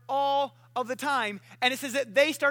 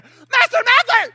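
high, frantic double shout; acted screaming for attention; freaking out; 12 semitones higher, 14 dB louder than usual. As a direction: yell urgently in mock panic. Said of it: "we're freaking out".

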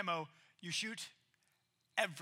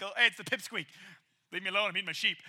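a lower, offhand lion voice; bored lion; 4 semitones lower, faster than usual. Acted. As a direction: brush off the little kid while chewing. Lion voiced dismissing boy.

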